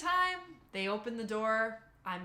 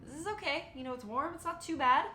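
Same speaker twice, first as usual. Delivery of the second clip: high nervous self-pep; 7 semitones higher than usual. Retold or imitated. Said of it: "I'm like".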